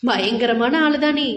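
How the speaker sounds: plain reading voice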